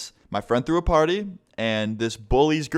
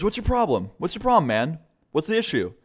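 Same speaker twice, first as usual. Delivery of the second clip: higher-pitched, insistent challenge; imitated confrontation; 6 semitones higher than usual. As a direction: play a teacher squaring up to a bully. Teacher confronts the bully in story.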